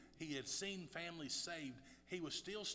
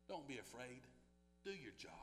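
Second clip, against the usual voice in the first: softer, lower, gentle assurance; quiet reassurance; 5 semitones lower, 12 dB quieter than usual. Acted. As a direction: say it softly to each listener. Quiet reassurance before loud repeat.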